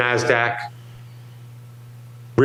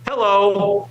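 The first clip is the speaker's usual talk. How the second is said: cheery greeting; 6 semitones higher, slower than usual. Retold or imitated. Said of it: "and they'll say".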